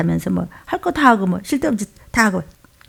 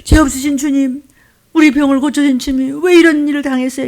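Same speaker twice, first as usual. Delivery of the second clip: women's lament; 5 semitones higher than usual. Acted. Women following Jesus to the cross, plaintive lament. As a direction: call out plaintively, stretching the words. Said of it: "여인들이".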